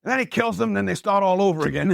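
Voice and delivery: high, raspy Italian grandpa telling a story; Pepe's raspy Italian voice. Retold or imitated. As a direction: imitate an elderly Italian storyteller, raspy and pitched well up. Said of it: "He's got this Italian raspy voice".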